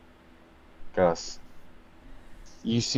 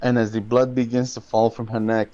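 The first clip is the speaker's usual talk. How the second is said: ominous narration; 3 semitones lower, 8 dB quieter than usual. A narrator's reading.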